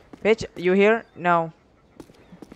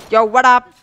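hype shout; 7 semitones higher, 10 dB louder than usual. Real, not acted. Catchphrase called out again.

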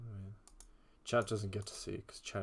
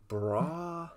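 frat bro; 7 semitones higher than usual. Pretend. Mocking frat bro slang.

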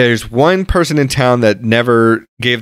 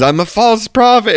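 mock scream; 9 semitones higher than usual. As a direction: mimic a desperate yell without full volume. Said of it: "screaming at the top of his lungs".

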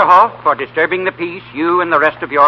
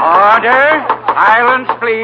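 booming, louder judge's command; loud call for silence; 6 semitones higher, 4 dB louder, much slower than usual. Acted. Judge silencing the crowded night court, loud and commanding. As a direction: bark it across the courtroom.

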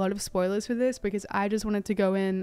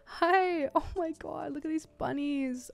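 cooing; 7 semitones higher, slower than usual. Real, not acted. Gamer cooing at a bunny, high and slow. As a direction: coo at it like a pet.

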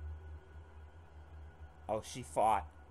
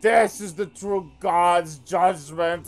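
loud, high raving shout; furious Tommy meltdown; Tommy Wiseau; 5 semitones higher, 11 dB louder, slower than usual. Acted. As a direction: rant like a madman knocking things off shelves. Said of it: "my rendition of Tommy Wiseau".

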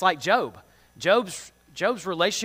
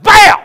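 sudden very loud, much higher burst of "Bam!"; explosion bam; 22 dB louder than usual. Shown as a demonstration. Showing kids a short temper, explosive "Bam!". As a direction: explode like a lit firecracker.

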